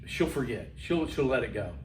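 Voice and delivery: low, soft aside to oneself; hushed, low private thought; dismissive self-reassurance. Retold or imitated. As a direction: play a kid quietly brushing off a summons. Child reassuring himself after being called.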